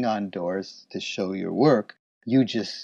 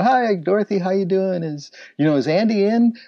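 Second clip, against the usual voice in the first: breezy hello pitched high, a little louder; chummy salesman greeting; 10 semitones higher, 4 dB louder than usual. Retold or imitated. Alan greets receptionist on his rounds, breezy and high. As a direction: sell the friendliness to the front desk. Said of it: "And I go".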